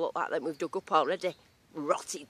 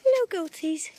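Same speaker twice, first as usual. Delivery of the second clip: cooing to goats; slower than usual. Real, not acted. Greeting the baby goats, high sing-song baby talk. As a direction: sweet-talk the little goats.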